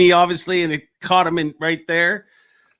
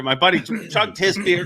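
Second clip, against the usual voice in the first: usual speaking voice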